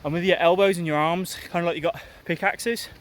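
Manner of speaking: breathy, loud talk mid-run; speaking while jogging. Real, not acted. Talks while running, loud.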